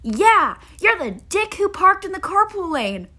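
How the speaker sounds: high, loud retort; triumphant yelled comeback. Retold or imitated. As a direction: fire it back with glee. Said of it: "this parent running the carpool lane goes".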